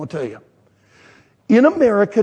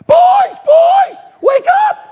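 loud, high-pitched shout to wake them; excited yell; 8 dB louder, slower than usual. Retold or imitated. Dad to his boys in their tents, excited yell. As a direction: yell them awake at full voice. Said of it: "And then I yelled".

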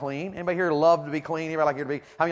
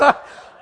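loud laugh; 11 dB louder than usual. Real, not acted. Laughs telling story.

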